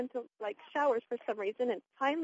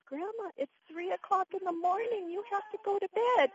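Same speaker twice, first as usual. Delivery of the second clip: much higher, insistent plea to Grandma; pleading granddaughter, imitated; 9 semitones higher than usual. Granddaughter trying to get Grandma back to bed, pleading. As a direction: imitate a granddaughter urging bedtime.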